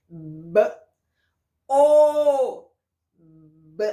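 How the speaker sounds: B as a lip pop, then O held loud; sounds taken apart. Shown as a demonstration. Teacher splitting bo into its sounds for children. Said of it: "qual sonzinho que elas vão fazer juntas".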